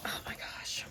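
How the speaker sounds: awed whisper. Real, not acted. Whispering in awe while unwrapping the gift. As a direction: hush it as the wrapping comes off. Unwrapping the bubble-wrapped books.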